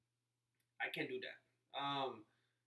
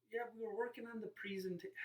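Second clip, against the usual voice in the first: higher-pitched mimicry, cut off mid-word; mock pretentious; 8 semitones higher than usual. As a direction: mimic someone putting on airs with PREE in "presenta-". Mimicking people who say it pretentiously.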